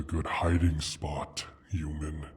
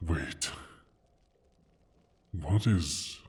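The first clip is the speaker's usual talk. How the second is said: ordinary talk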